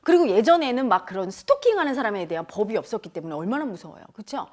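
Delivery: ordinary delivery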